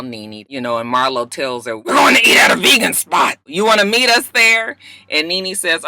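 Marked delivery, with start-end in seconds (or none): 1.8-4.8 calling out. Retold or imitated. Marlo calling Nene on bus.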